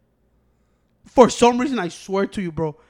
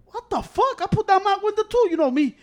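high, loud retold challenge; indignant outburst; 11 semitones higher, 6 dB louder than usual. Driver winds down his window at the other driver, indignant. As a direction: flare up, voice jumping high. Retelling his road quarrel.

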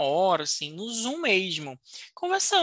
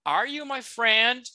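slow, with friend rising at the end; rising question tune; 4 semitones higher, slower than usual. Shown as a demonstration. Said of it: "da entonação como eu falo".

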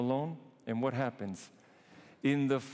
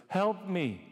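pleading blind man; 7 semitones higher than usual. Acted. Lepers begging Christ.

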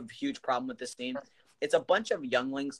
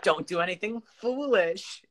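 slow, higher lecturing voice; mock stern Jedi; 7 semitones higher, 4 dB louder than usual. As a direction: wag a finger at kids. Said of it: "And they're like".

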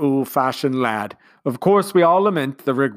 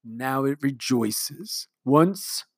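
lovesick; 4 semitones higher, 6 dB louder than usual. A narrator's reading. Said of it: "with a lovesick gaze".

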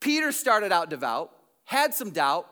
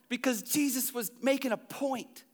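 ordinary talk

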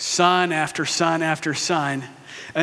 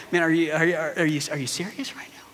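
hushed, mocking whisper between brothers; snickering whisper; 3 dB quieter, much faster than usual. Older brothers whispering mockingly about David. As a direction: whisper it behind their hands, half laughing.

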